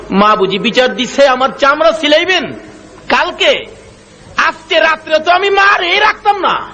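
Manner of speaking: high shouted outrage, acted; hot-tempered. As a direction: build to a furious high shout as the son. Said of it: "মেজাজ গরম". (রাগে চড়া গলায় চিৎকার)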